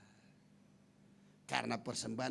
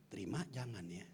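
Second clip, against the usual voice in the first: soft, low inner thought; 7 semitones lower, 6 dB quieter than usual. Shown as a demonstration. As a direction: murmur it to yourself. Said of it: "Dalam hati nih". (suara hati yang lirih)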